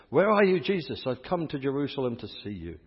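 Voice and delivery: imploring cry, acted out; calling out. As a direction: call out as if searching for someone. Retold plea to Jesus in Jerusalem.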